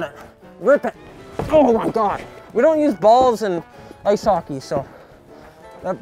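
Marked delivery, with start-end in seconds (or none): none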